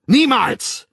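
loud, high cry of refusal; shout. A narrator's reading. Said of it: "rief der Wilde". (laut rufend)

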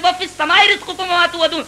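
fast, high run through the verse with السَّمَاءِ; quick recitation. Acted. Quran verse quoted again.

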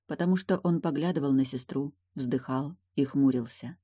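ordinary delivery, nothing marked

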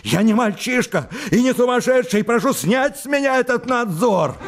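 high, strained cry near hysteria; high overwrought shout; shouting in agitation. Acted. Laevsky continues his tirade.

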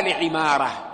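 لعمارة said with "ʕimāra", i after the ayn; لعمارة as "l-ʕi-MAA-ra"; kasra on the ayn. Shown as a demonstration. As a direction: give لعمارة an "ʕi" vowel. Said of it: "يقول بكسر العين".

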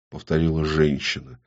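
ordinary delivery

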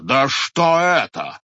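loud, slow, drawn-out indignation. A narrator's reading. Head repeating his outrage at the watchmen, slow and loud. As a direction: drag it out in outrage.

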